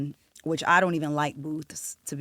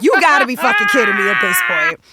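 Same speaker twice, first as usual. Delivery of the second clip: exasperated; 10 semitones higher, 7 dB louder than usual. Retold or imitated. Exasperated at the absurd demand.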